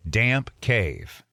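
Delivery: usual speaking voice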